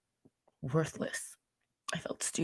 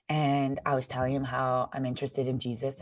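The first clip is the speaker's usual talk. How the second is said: normal talking voice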